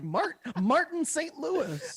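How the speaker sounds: a clueless fan fumbling the name; average Joe voice. Retold or imitated. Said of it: "your average Joe Schmo would totally just be like".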